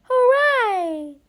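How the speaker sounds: loud, high cheering shout; joyful shout. Acted. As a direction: cheer it out with joy. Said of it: "shouted the lost boys".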